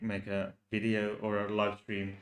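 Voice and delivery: ordinary talk, nothing marked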